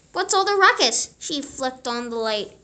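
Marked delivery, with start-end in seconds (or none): 0.1-1.1 high, loud, quick scolding; scolding mother. A narrator's reading. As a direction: demand it fast and loud.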